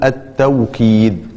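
التوكيد with a clear W glide, at-taw-keed; with a waw. Shown as a demonstration. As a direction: say التوكيد with "aw" before the k. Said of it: "بالواو تقول".

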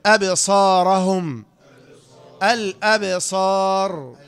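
very slow recitation, bouncing the ب in "أبصارهم" and "الأبصار"; qalqalah recitation. Shown as a demonstration. Teacher demonstrating qalqalah, slow bounced ب in "أبصارهم" and "الأبصار". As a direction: stretch it out and pop the ب of "أبصارهم" and "الأبصار". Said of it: "قلقلة يعني اهتزاز يحدث في مخرج الحرف".

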